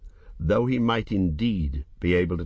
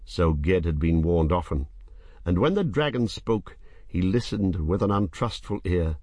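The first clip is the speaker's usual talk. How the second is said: usual speaking voice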